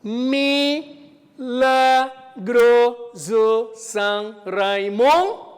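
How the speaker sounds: Milagroso São Raimundo spoken slowly and loudly, pausing to count each poetic syllable; slow scansion. Shown as a demonstration. Teacher counting syllables aloud for the class, slow and loud.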